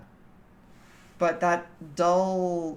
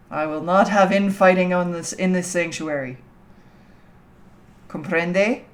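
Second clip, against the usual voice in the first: mock stern warning; 8 dB louder than usual. Scolding chat about infighting.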